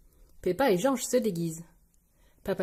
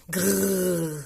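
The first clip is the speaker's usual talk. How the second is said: long rolled growl; dinosaur growl; 2 semitones lower than usual. Acted. George in costume facing Peppa's friends, growling.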